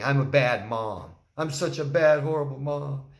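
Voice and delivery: hammed-up wail of self-pity. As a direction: whine out I'm a bad mom like a pity plea. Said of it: "someone saying".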